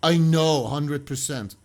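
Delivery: loud, slow overconfident claim; hammed-up certainty; mock know-it-all. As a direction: parody a smug online sleuth. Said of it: "I'm not going to be like mom for justice and say".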